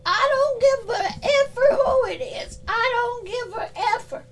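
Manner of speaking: high put-on voice, half crying and laughing; high mock-wailing defiance; high defiant voice. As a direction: squeal that you don't care. Voicing a defiant spirit.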